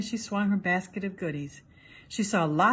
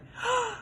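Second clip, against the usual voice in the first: relieved gasp; 14 semitones higher, 4 dB quieter than usual. Acted. Grandma and girl come out.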